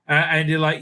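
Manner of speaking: ordinary talk, nothing marked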